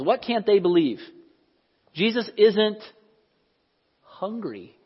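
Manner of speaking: ordinary talk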